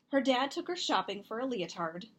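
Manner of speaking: plain reading voice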